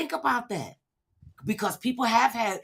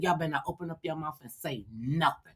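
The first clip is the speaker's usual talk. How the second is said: low, hushed threat to the congregation; low warning; 4 semitones lower, 10 dB quieter than usual. Retold or imitated. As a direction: warn them quietly, daring anyone to speak. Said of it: "I said".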